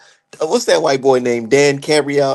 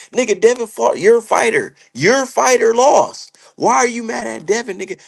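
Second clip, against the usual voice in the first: scolding; 8 semitones higher, 3 dB louder than usual. Real, not acted. Scolding Oscar.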